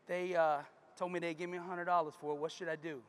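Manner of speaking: quiet, low and downcast; a daughter who is losing hope, speaking softly; disheartened daughter. Acted. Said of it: "she's kinda disheartened".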